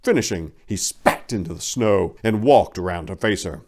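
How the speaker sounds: ordinary delivery, nothing marked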